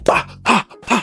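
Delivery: sharp high pa sounds for each strike; rapid mock impact sounds; elbow strike noises. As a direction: hammer out the blows vocally. Imitating elbows landing.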